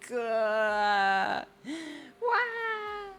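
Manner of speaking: loud high squeal with a gasp; excited squeal. Real, not acted. Reader reacting to the story, excited squeal. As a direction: squeal out loud, pitch shooting up.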